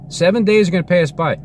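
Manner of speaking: high-pitched, emphatic insistence; insistent repeat. Real, not acted. Telling everybody what is coming, high and insistent. Prediction repeated with more force.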